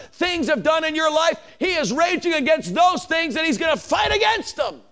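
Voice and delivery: loud-hearted preacher building to a high shout; impassioned pulpit crescendo; fired-up preaching. Real, not acted. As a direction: preach it with rising fervor, high and driving. Preaches at full force.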